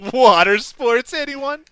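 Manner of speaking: high, slow camp lilt with a rising end; imitating the soldier. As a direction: tease it out slowly like a leering invitation. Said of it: "he goes".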